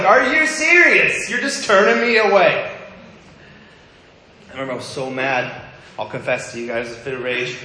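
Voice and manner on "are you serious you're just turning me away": much higher and louder, stretching out serious; hurt, furious protest at being turned away; high, loud, slowed-down outrage; outraged disbelief. Retold or imitated. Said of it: "I was like".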